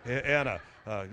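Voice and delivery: normal talking voice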